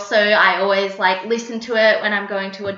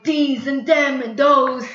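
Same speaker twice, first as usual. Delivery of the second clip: put-on strong New York accent; 5 semitones higher, slower than usual. Wife imitating her drunk or angry husband, exaggerated New York accent. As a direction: punch each word out slowly in hammed-up New York. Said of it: "when he gets drunk or he gets angry".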